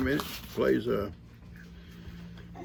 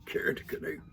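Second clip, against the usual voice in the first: "Karen the Canuck" whispered to himself; 7 dB quieter than usual. Shown as a demonstration. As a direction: savor the name in a whisper. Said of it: "I love that".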